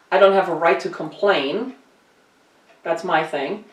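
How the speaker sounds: usual speaking voice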